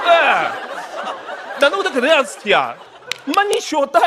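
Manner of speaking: high, loud Shanghainese grumbling; Shanghainese dialect. Shown as a demonstration. Comic bemoans his lot, exasperated Shanghainese. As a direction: complain fast and loud in Shanghainese. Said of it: "上海话讲得老好".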